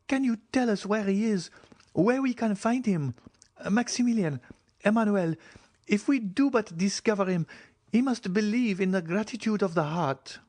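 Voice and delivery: put-on high, urgent cry of a young woman. Julie cries out to the count, high and urgent. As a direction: cry out in desperate hope. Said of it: "cried Julie".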